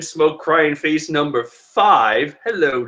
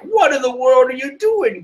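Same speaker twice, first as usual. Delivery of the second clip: shocked crowd; 10 semitones higher than usual. Retold or imitated. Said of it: "everyone is like".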